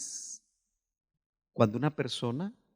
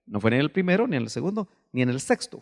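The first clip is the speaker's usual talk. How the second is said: usual speaking voice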